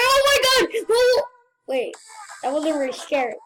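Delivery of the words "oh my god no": high, loud startled outburst; sudden panicked yell; scared scream. Real, not acted. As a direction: cry out in sudden fright. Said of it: "I get really scared very easily".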